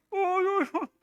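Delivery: high-pitched mock yelps of pain; pained moaning. Woman with her bleeding tongue, pained moaning. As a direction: whimper "uy" high, as if hurt. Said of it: "Y estaba así".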